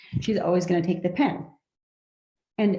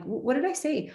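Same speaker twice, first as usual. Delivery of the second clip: curious; 6 semitones higher than usual. Shown as a demonstration. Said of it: "see how I'm being very curious".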